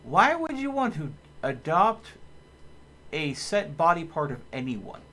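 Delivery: high, loud baffled disbelief; what-the-heck incredulity; incredulous. Real, not acted. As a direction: react to a ridiculous chat question. Baffled reaction to chat request.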